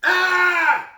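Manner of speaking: high, loud cry of frustration; frustrated wail. Acted. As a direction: let out a despairing child's wail. Ralph stuck, cannot find story.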